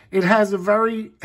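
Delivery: usual speaking voice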